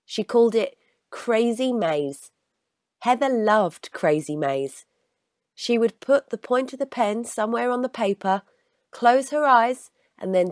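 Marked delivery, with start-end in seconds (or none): none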